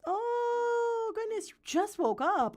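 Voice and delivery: high surprised gasp of delight; startled high oh. Real, not acted. Surprised at someone waking up.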